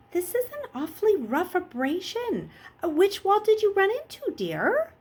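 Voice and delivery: put-on high, far-off old woman's voice; sweet-but-prying grandma; old nurse voice. Nurse questioning Luke about his wound, high old voice.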